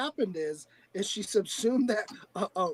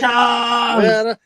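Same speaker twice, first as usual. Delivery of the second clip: loud cheer; 3 semitones higher, 12 dB louder, much slower than usual. Real, not acted. Cheering the giveaway winner.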